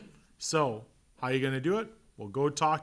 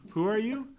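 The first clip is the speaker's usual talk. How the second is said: receptionist; 10 semitones higher, 4 dB louder than usual. Retold or imitated. Receptionist questioning a visitor.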